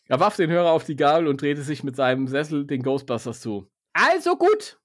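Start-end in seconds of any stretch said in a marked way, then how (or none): 3.9-4.8 much higher, impatient snap; impatient broker; impatient call. Acted. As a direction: get on with it. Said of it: "rief er ungeduldig".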